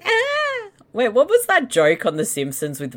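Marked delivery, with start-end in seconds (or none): 0.0-0.7 high, sudden yelp; quick high squeal; startled yelp. Real, not acted. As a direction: jump at the moving meat. Meat moves on screen.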